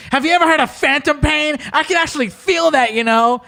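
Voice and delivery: high, earnest mock complaint; mock whiny gamer. VR user telling the troll he feels phantom pain, high mock whine. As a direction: squeak it out like a hurt nerd.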